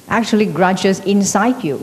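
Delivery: ordinary talk, nothing marked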